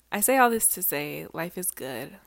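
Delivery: ordinary delivery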